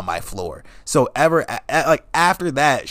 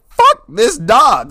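loud drawn out exasperated; 11 semitones higher, 10 dB louder, much slower than usual. Retold or imitated. Exasperated outburst.

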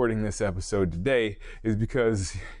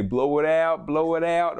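higher mock-Delco trainer's catchphrase; attempted Delco accent; 7 semitones higher, 4 dB louder than usual. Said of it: "says it with his Delco accent".

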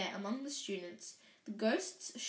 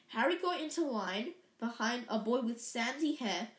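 plain reading voice

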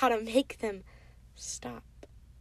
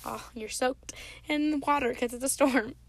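ordinary talk

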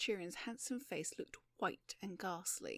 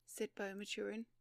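ordinary delivery

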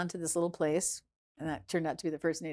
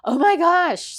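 loud, high-pitched astonished exclamation; retold shock; 10 semitones higher, 11 dB louder, slower than usual. Watching her start crying too, loud high mock shock.

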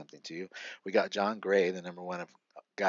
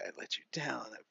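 softer, choked-up apology; tearful apology; 4 semitones higher, 8 dB quieter, faster than usual. Retold or imitated. Confessing to his wife on the hotel deck, softly tearful. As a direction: say it as if you've failed her. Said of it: "And I started crying".

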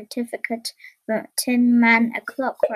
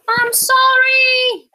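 high, loud, drawn-out call; slow, sing-out holler; 12 semitones higher, 7 dB louder, much slower than usual. Acted. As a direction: shout an apology from far away. Wizard floating away in balloon.

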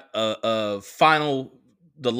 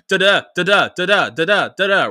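punchy, louder bursts of nonsense syllables; mock rapid-fire chatter; 4 semitones higher, 6 dB louder than usual. Mimicking girlfriend talking at him nonstop, punchy rapid-fire chatter. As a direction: imitate someone rattling on at you in bursts. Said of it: "rapid pace".

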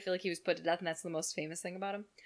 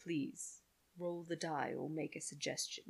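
lower, slowed game announcer; computer game voice; 4 semitones lower, 9 dB quieter than usual. Retold or imitated. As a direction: announce the turn like a serious computer voice. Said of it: "Did you ever play that game on the computer".

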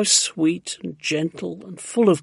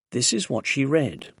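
plain reading voice

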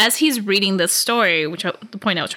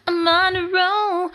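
high, drawn-out playful boast; sing-song little brag; playful self-congratulation; 8 semitones higher than usual. Real, not acted. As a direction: stretch it out high and pleased. Celebrates after listener confirms it.

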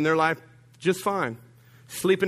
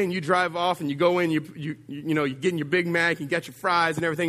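usual speaking voice

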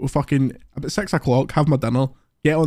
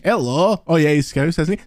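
usual speaking voice

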